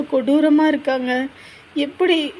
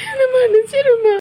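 higher, louder tearful plea; crying plea; 8 semitones higher, 4 dB louder than usual. Acted. Daughter pleads with mother again, sobbing and higher. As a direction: break down begging forgiveness.

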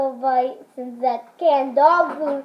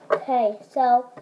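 ordinary talk, nothing marked

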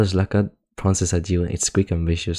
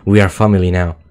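loud proclamation; 10 dB louder than usual. Acted. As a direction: boom it out louder, like a ritual chant. Reading the creatures' lines.

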